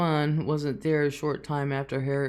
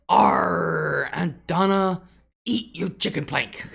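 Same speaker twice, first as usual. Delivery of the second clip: mild imitated pirate girl; a hint of a pirate kid; 4 semitones higher, 5 dB louder, much slower than usual. Retelling granddaughter at the table, light pirate voice.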